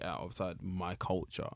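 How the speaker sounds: usual speaking voice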